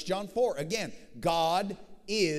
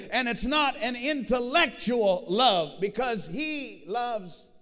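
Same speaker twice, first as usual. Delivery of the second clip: loud, rising preacher's cry, higher and louder; swelling gospel preaching, near song; full-force preaching; 6 semitones higher, 6 dB louder than usual. Acted. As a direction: preach it to the rafters, building. Preaching climax on God's love.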